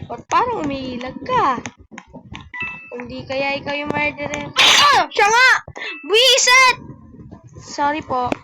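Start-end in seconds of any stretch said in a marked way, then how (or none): 4.6-6.8 very high, loud shrieking in shock; startled, frightened yelling; panicked screams. Real, not acted. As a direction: shriek as the killer is found. Discovers the murderer in game.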